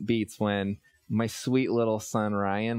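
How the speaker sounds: normal talking voice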